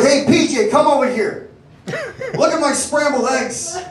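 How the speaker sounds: hammed-up cranky old customer calling the waiter over; mock fussy customer griping about his eggs; complaining regular.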